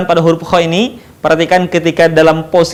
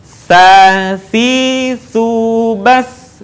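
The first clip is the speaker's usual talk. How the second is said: "Sa, si, su, bas" joined in a drawn-out chant; swung syllables; much slower than usual. Shown as a demonstration. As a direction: swing through "Sa, si, su, bas" with long vowels. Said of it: "gabungkan dengan ayu-ayun". ("Sa, si, su, bas" diayun panjang)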